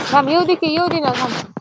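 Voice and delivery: sudden loud call, easing slightly at the end; shouted command. Real, not acted. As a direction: holler at them to wait. Shouting at animals while livestreaming.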